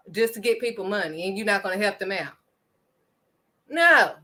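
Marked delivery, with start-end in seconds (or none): 3.7-4.1 a sharp, louder, higher no; emphatic no. Real, not acted. Preacher rebuking the congregation, sharp loud no.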